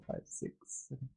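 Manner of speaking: counting under his breath, slowly; whispered counting. Real, not acted. Co-caster tallying the days to his birthday, whispering slowly. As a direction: whisper the numbers to yourself as you tally.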